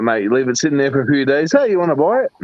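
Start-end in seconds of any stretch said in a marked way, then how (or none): 1.5-2.3 shady seller. Retold or imitated. Voicing a seller in a joke.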